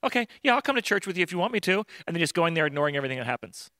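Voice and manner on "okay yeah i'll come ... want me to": mock glossing over. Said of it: "just glossing over and going".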